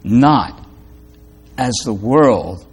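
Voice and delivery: quiet, fading voice of God giving peace; gentle divine voice. Acted. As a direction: let it sink to a hush as you bless them. God speaking peace to listener.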